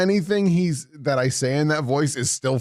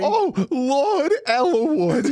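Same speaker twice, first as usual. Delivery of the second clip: slow, higher put-on lordly voice; Lord Elwood voice; 9 semitones higher, much slower than usual. To a friend who loves the voice, a hammed-up lord. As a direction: drawl out the lord's name grandly. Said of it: "one of my favorite voices that you've done".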